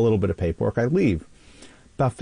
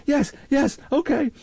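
high, panicked whining pleas; panicked punk. Retold or imitated. Punk dragged by the ear begs captain, panicked and high. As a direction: squeal it out like a terrified kid caught. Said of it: "The guy goes".

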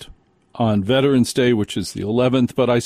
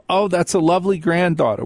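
higher, gushing well-meaning stranger; sweet imagined compliment; mock stranger; 7 semitones higher than usual. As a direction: coo it like a kindly stranger.

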